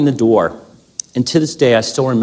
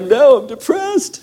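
much higher, slow blubbering whine; mock crying whine; slower than usual. Husband sobs his news to his wife, mock whine. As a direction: whimper it like a crying baby.

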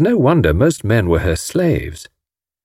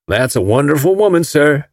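put-on Alabama accent; 5 semitones higher than usual. A narrator's reading. Hank Ryder admiring Marguerite, Alabama accent. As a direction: play an admiring Alabama gentleman. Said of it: "Mister Ryder came from Alabama".

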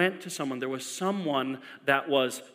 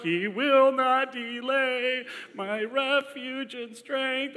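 high, slow, breaking with tears; teary scripture recital; 7 semitones higher, much slower than usual. Retold or imitated. Crying in church before the birth, high, slow and breaking. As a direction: voice cracking as he recalls the verse.

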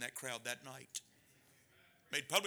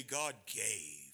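ordinary talk

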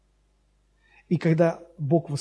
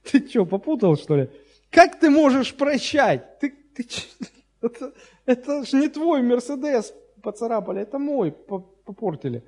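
russian street thug; 8 semitones higher than usual. Retold or imitated. Он скажет.

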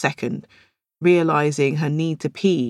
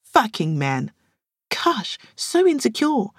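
scoffing, rising to a higher slow sneer; disgusted reaction; 7 semitones higher, slower than usual. Acted. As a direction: say it with contempt. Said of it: "Each woman reacted visibly".

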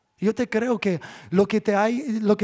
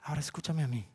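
softer, low and firm; quiet firm; 4 semitones lower, 10 dB quieter, much faster than usual. Acted. Pastor voicing God asking to be heard, softer and firm. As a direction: speak it as God calling for attention.

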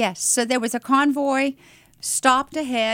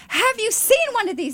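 high, eager question; excited; 11 semitones higher than usual. Retold or imitated. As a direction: ask it with overflowing enthusiasm. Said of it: "as an excited I could".

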